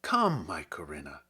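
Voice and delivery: measured, lyrical poetry reading; poem reading. Acted. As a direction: read it like verse for a listener. Reads a Herrick poem aloud.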